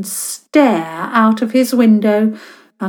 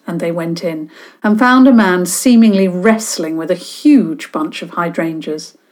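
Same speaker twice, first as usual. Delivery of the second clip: usual speaking voice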